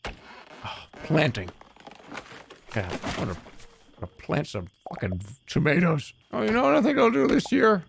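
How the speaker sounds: lightly voiced middle-aged guy savoring garden plans; dreamy older self. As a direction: muse fondly about grass and tomatoes, half mocking. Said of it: "now I'm just sitting there thinking like".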